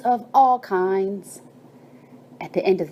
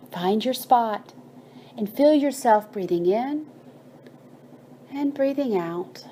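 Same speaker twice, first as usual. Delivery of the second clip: guided meditation. Acted. Narrator guiding children to feel their breath, in a calm meditation voice. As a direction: gently lead listeners to notice their breath.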